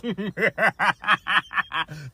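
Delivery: a high burst of laughter; a real high laugh. Real, not acted. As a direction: crack up at the punchline. Laughing at own punchline.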